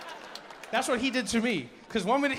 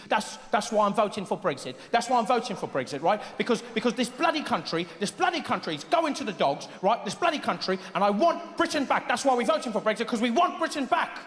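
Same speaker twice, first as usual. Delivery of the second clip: put-on worked-up British accent, pitch climbing; British Brexit voter; 3 semitones higher than usual. Brexit voter talking to the BBC, indignant British rant. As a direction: rant like an aggrieved Brit on the news. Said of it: "people on the news talking to the B B C".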